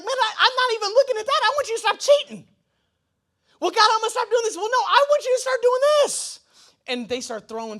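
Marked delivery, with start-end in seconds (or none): 0.0-6.4 higher, louder exasperated back-and-forth; mock exasperated God. God brushing off a sinner's bargains, mock exasperated. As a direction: play God losing patience with excuses.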